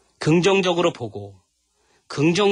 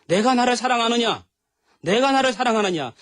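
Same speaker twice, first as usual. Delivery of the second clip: raised, pleading question; Jesus pressing Peter with a raised, earnest question; Jesus asking Peter; 9 semitones higher than usual. Acted. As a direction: ask it higher, insistently. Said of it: "물어보잖아요".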